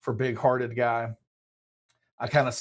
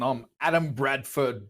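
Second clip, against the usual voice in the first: ordinary talk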